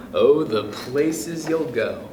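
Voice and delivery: ordinary delivery, nothing marked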